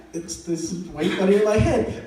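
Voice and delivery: normal talking voice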